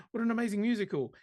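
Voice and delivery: breathless mock rave; gushing fan. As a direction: gush like a starstruck fan. Gushing fan quote after gasp.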